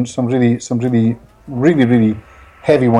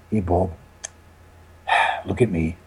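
a hushed, choked-up "hey, look at me" with a sigh; soft emotional call; 6 semitones lower, 7 dB quieter than usual. Retold or imitated. Chef softly calls to his old therapist over the microphone, moved. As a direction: quieter and lower, moved.

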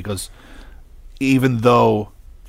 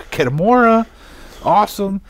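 excited; 11 semitones higher, 5 dB louder than usual. Retold or imitated. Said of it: "I was like".

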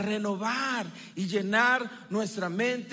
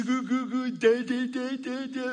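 lightly voiced baby cooing gu gu ta ta; a hint of a babbling baby; baby babble. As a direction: coo like a happy infant, lightly.

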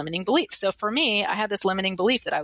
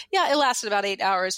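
usual speaking voice

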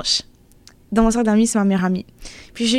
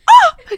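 sudden high, loud mock-horrified "oh"; shocked cry; 8 dB louder than usual. Daughter imagines talking that way to her mother, shocked yelp.

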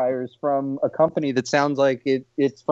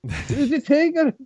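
panicked farmer call; 12 semitones higher than usual. Pretend. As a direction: plead down the phone for help. Frantic farmer call to police.

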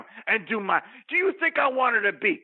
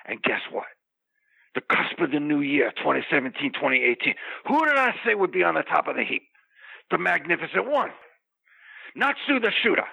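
low, intense wrestler promo voice; wrestling promo; 3 semitones lower than usual. Acted. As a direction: cut a heel promo, low and menacing. Wrestling promo character rant.

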